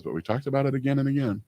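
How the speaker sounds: a quick lightly mimicked repeat; quoting spouse. Host echoing the spouse's words, fast light mimicry. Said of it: "what his spouse sometimes says".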